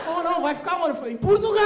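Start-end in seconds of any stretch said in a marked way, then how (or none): 0.2-0.9 loud impatient officer; officer shouting. Retold or imitated. 1.1-1.6 loud indignant answer; shouting. Retold or imitated.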